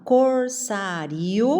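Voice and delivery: "corsário" slowly as cor-SÁ-rio, rising at the end; syllable by syllable. Shown as a demonstration. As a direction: stretch cor and sá, lift the rio. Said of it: "você fala".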